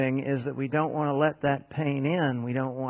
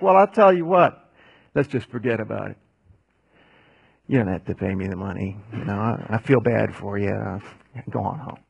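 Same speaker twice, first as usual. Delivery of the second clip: easy, lower, quicker king forgiving; casual king; 5 semitones lower, 4 dB louder than usual. Acted. King tells the servant to forget the debt, relaxed and low. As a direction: toss off the debt like it's nothing. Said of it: "the king's like".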